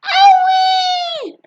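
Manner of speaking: very high, loud long cry; Grandma screaming; shrill scream. Acted. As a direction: scream as she shoots up. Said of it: "she screamed".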